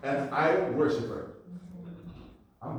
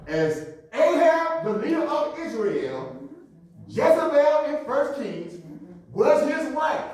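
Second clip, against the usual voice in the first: slow, raised, stretched-out sermon climax; forceful preaching; 11 semitones higher, 9 dB louder, slower than usual. Acted. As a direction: proclaim each phrase slowly to the congregation.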